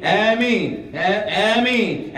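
high, drawn-out mock Ameen; blind joining in. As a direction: imitate someone eagerly following the crowd. Mimics people blindly joining in.

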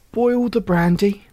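usual speaking voice

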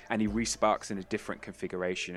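ordinary talk

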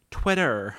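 put-on American pronunciation of Twitter; American accent. Shown as a demonstration. Podcaster showing a word learned on West Coast, American accent. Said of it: "say with an American accent".

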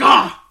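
short loud startled yell; loud oogie-boogie scare; mock scare shout. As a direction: jump out like a clown scaring him. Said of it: "He's like".

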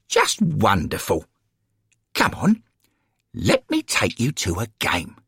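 put-on high, enthusiastic coaxing from an old lady; eager elderly lady supporter. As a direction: talk him into coming along. Florence coaxes the newcomer.

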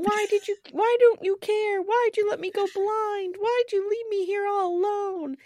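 high-pitched imitated wail; mock crying. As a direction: plead like a crying child, hammed-up. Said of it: "Timmy's crying, and he's saying".

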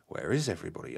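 ordinary talk

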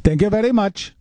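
put-on Indian accent. Shown as a demonstration. Host proving to caller he can do it, Indian accent. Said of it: "See, I could do an Indian accent".

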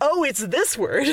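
slow, high dawning "oh"; lightly hammed-up discovery; mock realization. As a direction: act out the penny dropping. Realizing what the spelling means.

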